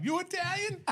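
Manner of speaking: very high, incredulous squeal; Providence Italian guy. Retold or imitated. Providence man discovers a fellow Italian, high mocking impression. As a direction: pitch way up like a thrilled countryman. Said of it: "That's what he said".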